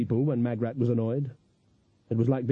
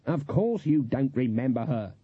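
usual speaking voice

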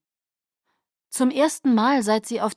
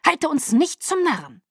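1 hissing; 8 semitones higher, 4 dB louder than usual. A narrator's reading. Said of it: "fauchte Caius".

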